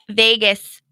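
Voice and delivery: normal talking voice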